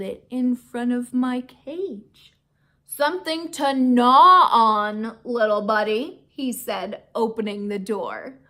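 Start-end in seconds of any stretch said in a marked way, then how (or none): 2.8-6.2 loud, friendly offer; hearty. Acted. Aldo offering a biscuit, loud and hearty. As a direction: boom it kindly.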